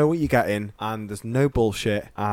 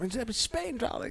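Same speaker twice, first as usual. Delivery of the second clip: much higher, soft, airy grande-dame voice; grand dame drawl; 14 semitones higher, 7 dB quieter than usual. Retold or imitated. Imitating a drag queen abroad, high and grand. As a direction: swan it like a glamorous old diva. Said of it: "she's like".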